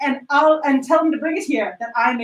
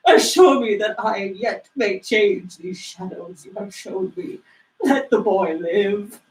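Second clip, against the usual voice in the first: low pleading; 6 semitones lower than usual. Acted. Scrooge pleading with the spirit.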